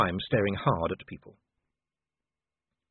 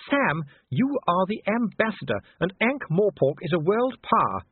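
high-pitched, ladylike insistence; Sybil, reproving wife; 10 semitones higher than usual. A narrator's reading. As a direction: remind the husband firmly, pitched high.

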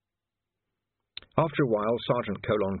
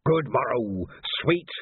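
hammed-up theatrical greeting, higher; comic gravedigger; 4 semitones higher, slower than usual. A narrator's reading. Ledgy greeting the watchmen in comic gravedigger style. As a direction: ham it up like a stage gravedigger. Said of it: "None of that comic gravedigger stuff".

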